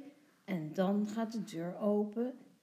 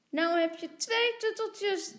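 put-on high, loud childish exclamation; little girl voice; 12 semitones higher, 5 dB louder than usual. A narrator's reading. Lotje tells Tommy he has two, piping up. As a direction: pipe up like a small girl. Girl speaks happily to her brother.

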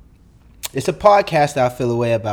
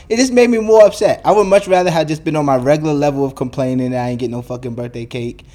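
ordinary delivery

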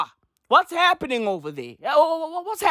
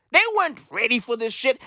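usual speaking voice

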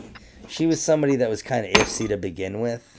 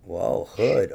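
ordinary talk, nothing marked

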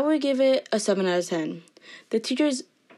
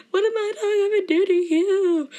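hammed-up high, loud wail; mock crying; 12 semitones higher, 6 dB louder than usual. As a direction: plead in a fake tearful whine. Mock crying defence of dog.

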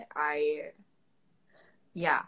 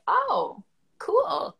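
higher, fake-bright sarcasm; mock sarcastic; 11 semitones higher, 5 dB louder than usual. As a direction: pretend to be pleased, higher. Mock reaction to dropped accent.

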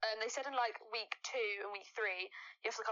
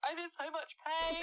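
mock pained wail; 7 semitones higher than usual. Teasing the friend who limped over, mock pained wail. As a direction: mimic someone hurting, pitched up. Mimics friend limping in pain.

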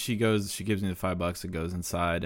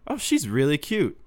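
mom's voice, higher and louder, playfully hinting; teasing mom; 7 semitones higher, 4 dB louder than usual. Retold or imitated. Mom teasing her son about the waitress, higher and playful. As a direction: nudge him like a meddling mom.

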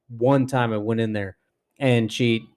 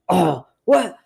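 higher, alarmed inmate voice; mimicking the inmate; 9 semitones higher, 5 dB louder than usual. Imitating an inmate questioning the officer, high and suspicious. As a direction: ask it like she's suspicious. Inmate asking about lockdown.

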